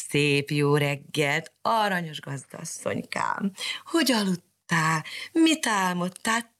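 slow, purring cat voice; wheedling cat. Acted. Sooty cat flattering Mariska, slow purring voice. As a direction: coax her slowly like a cat.

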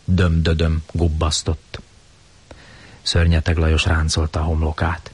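ordinary delivery, nothing marked

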